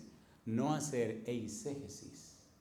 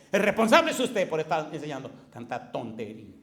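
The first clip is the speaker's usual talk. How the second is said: loud, higher, heated argument; retold furious tirade; 5 semitones higher, 8 dB louder than usual. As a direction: hammer the point home, louder and higher. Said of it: "empecé a decirle cosas a ese hombre, pero rabioso". (diatriba furiosa y fuerte)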